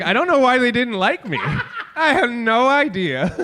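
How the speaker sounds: lightly mock his puzzled complaint; imitating the co-host. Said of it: "I like how he's like".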